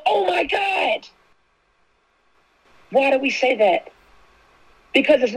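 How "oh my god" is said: high, slow, breathless Oh my God; shocked gasp. Retold or imitated. Imitating how people react to an emergency, stunned. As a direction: gasp it out like disaster just struck. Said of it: "We go".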